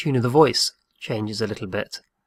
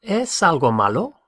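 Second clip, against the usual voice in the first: "¿Es algo malo?" lifted high on "Es", rising end; teacher modelling question intonation; 5 semitones higher than usual. Shown as a demonstration. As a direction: make it a clear question by pitch. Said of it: "What about".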